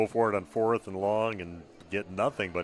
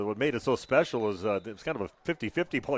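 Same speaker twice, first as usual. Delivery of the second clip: ordinary talk, nothing marked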